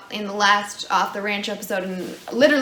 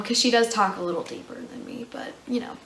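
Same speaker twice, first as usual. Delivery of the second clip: trailing aside; 2 semitones lower, 8 dB quieter than usual. Real, not acted. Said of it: "make her voice a little bit, like, deeper".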